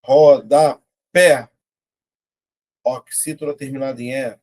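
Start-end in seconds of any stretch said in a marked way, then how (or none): 0.0-1.5 RODAPÉ very slow and loud, ro-da-pé; RODAPÉ in three loud, spaced beats; syllable by syllable. Shown as a demonstration. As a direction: punch out each syllable of RODAPÉ separately. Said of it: "vou separar".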